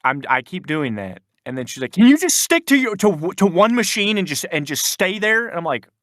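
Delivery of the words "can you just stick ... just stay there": high, loud mock screech of an irate coworker; imitated screaming. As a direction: mimic her screaming, pitched way up. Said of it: "'cause she's screaming at me".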